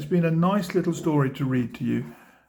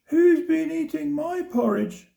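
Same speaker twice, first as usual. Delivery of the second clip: much higher squeaky little bear; teeny tiny squeak; 13 semitones higher than usual. A narrator's reading. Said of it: "squeaked the teeny tiny bear in his little voice".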